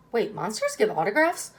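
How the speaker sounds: ordinary delivery, nothing marked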